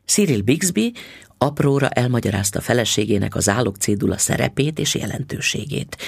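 plain reading voice

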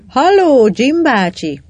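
loud, high hail across the water; calling back. A narrator's reading. Peggy hails her uncle in the rowing boat, calling back. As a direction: call out a drawn-out hello to an uncle. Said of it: "kiáltott vissza Peggy".

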